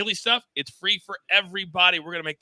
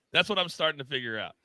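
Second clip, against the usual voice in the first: ordinary talk, nothing marked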